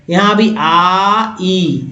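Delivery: आ then ई, drawn out slowly; slow vowel split. Shown as a demonstration. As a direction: pull the two vowels apart slowly. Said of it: "यहाँ देखिए जो कि". (आ, ई धीरे-धीरे अलग करके)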